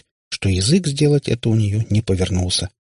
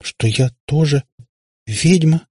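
slow, higher-pitched faltering question; halting, tongue-tied and incredulous; stunned babble; 7 semitones higher, slower than usual. A narrator's reading. Katya asks whether she is a witch too, stammering out slowly. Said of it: "непослушным языком пролепетала она".

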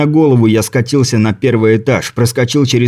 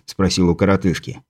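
ordinary delivery

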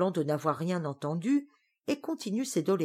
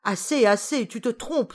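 louder protest; cry out; 5 semitones higher, 5 dB louder than usual. Acted. Witch protesting to her cousin, louder cry. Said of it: "s'écrie Griselle".

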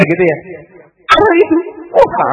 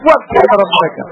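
fervent preaching; 11 semitones higher than usual. Acted. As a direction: preach it with swelling fervor. Preacher builds to full force.

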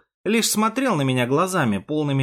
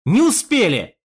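loud, higher outburst; bitter frustration; 7 semitones higher, 5 dB louder than usual. A narrator's reading. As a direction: cry out that it is too late. Досада над умершим комсоргом. (горькая досада)

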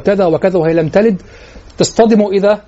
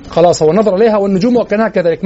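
ordinary delivery

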